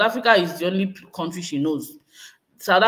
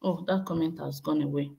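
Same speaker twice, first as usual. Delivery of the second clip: normal talking voice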